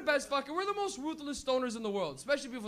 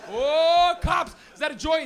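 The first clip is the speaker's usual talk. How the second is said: mock shocked shout; 3 semitones higher, 8 dB louder, slower than usual. Stoner pretending to spot a joint for the cops, loud mock surprise. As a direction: play innocent with a loud exaggerated whoa. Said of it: "I'm just".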